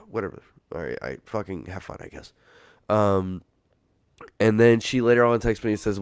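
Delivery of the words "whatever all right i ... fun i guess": resigned sigh. Retold or imitated. Resigned reply to girlfriend going drinking.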